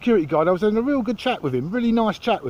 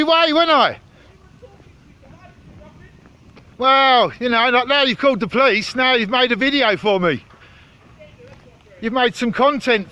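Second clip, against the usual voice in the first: raised, goading, mocking call to Denzel; loud taunting; 6 semitones higher, 4 dB louder than usual. Real, not acted. Filmer mocks the guard who called police, raised and goading. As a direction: needle him loudly, sarcastic and high.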